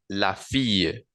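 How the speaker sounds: la fille with fille as fiye, said carefully; French demo. Shown as a demonstration. Teacher modelling la fille for students. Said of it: "en el femenino singular sería la".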